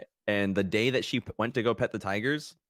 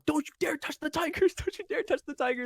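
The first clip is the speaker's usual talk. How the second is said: much higher panicked mom screech; shrieking mom. Retold or imitated. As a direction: screech the warning like a frantic mother. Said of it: "so my mom was like".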